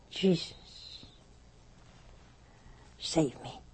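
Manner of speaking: whispered, desperate prayer; frightened plea. Retold or imitated. Lost man whispers to Jesus for rescue, afraid. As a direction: beg for rescue under your breath.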